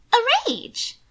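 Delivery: high, breathless genteel lady; high nervous young lady explaining herself; Mina pleading. A narrator's reading. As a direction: fuss anxiously in a high voice. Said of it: "Mina asked".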